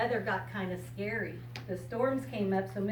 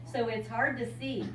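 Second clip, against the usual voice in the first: bright storybook reading voice; reading to a child; 3 semitones higher, 9 dB louder, slower than usual. Acted. Grandmother reading a picture book to a child, bright storybook voice. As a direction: read it out like a picture book for a little one.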